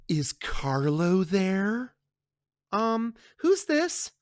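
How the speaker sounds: slow, sly woman's voice; the other woman taunting Connie over the phone, slow; smug mistress. Acted. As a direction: tease the wife without saying it. Mistress calls Carlo's home.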